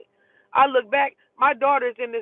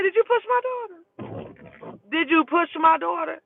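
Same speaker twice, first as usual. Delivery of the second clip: confronting demand; 7 semitones higher than usual. Retold or imitated. Confronting woman on bus.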